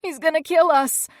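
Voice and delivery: put-on a terrified girl sure he'll come for them; trembling with fear. A narrator's reading. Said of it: "trembling with fear".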